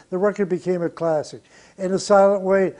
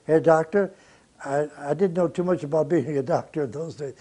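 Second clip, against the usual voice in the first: usual speaking voice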